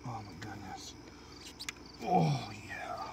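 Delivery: unvoiced whisper under the breath. Real, not acted. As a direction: murmur it to yourself. Whispering to himself at work.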